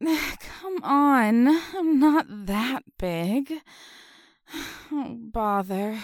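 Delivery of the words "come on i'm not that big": louder, slow strained push; straining. Acted. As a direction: force the words out with effort. Pushing herself through.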